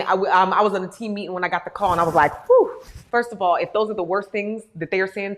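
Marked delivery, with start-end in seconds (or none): 2.5-2.8 sharp high whistle-like "whew"; startled exhale. Retold or imitated. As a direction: let out a loud, high-pitched whew of relief and shock. Reacting to school bullying call.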